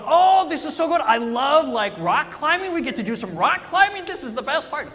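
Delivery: loud, high mock delight; gleeful friend. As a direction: rave about rock climbing like a thrilled kid. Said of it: "And J C goes".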